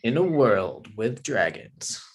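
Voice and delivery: slow, dramatic trailer announcer; movie trailer voice. Pretend. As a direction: read it like an epic movie trailer. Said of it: "like a, a movie trailer".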